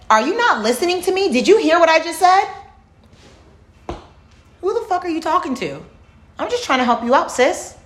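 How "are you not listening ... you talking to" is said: higher, indignant, in disbelief; retold confrontation. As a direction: play the outraged retort, pitched up. Retold argument with the mom.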